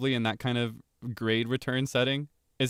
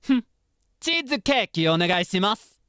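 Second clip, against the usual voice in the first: much higher, louder haughty princess voice; hime dere; 10 semitones higher, 7 dB louder than usual. Acted. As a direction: order it like a spoiled anime princess. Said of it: "Hime dere".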